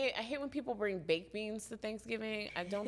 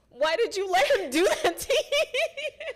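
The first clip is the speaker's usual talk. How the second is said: laughing; 12 semitones higher, 8 dB louder than usual. Retold or imitated. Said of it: "I was like".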